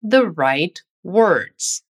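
very slow, stretched-out The right words; slow model phrase. Shown as a demonstration. Teacher drilling The right words very slowly. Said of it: "Vamos praticá-lo nesse trecho".

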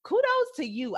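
bright, beaming praise with a smile; smiling voice. Real, not acted. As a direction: grin through the words. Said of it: "I'm smiling so hard right now".

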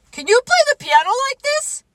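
very high, loud, rapid question; boastful cry. Acted. Toad showing off piano to Frog, high excited cry. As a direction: show off in a fast, high shout. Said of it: "cried Toad".

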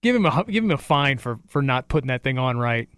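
ordinary talk

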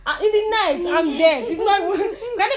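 high, loud laugh-cry at her own doom; laughing panic. Real, not acted. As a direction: laugh through remembered panic. Realising she's in trouble.

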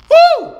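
very high loud joyful woo; ecstatic whoop. Real, not acted. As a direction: let out a church whoop. Preacher's ecstatic cry.

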